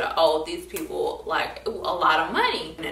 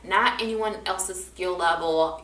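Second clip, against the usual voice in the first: usual speaking voice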